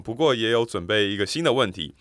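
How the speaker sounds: normal talking voice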